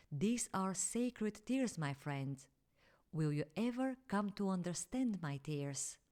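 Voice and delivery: ordinary delivery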